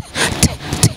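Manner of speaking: t, t clicked as bare voiceless taps; unvoiced t. Shown as a demonstration. Teacher showing the sound to avoid: bare voiceless t taps. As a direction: tap the tongue: t, t with no voice. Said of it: "sin tener que hacer t".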